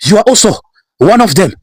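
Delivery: high-pitched accusing cry; angry accusation. Acted. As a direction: throw the blame at him, voice high and sharp. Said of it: "David is supposed to say".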